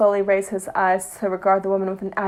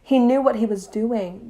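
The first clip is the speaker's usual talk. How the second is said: usual speaking voice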